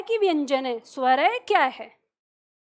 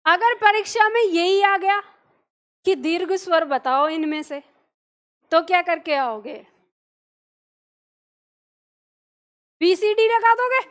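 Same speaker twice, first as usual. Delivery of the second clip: rising challenge; 2 semitones higher, 4 dB louder than usual. Real, not acted. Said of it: "teacher challenging students".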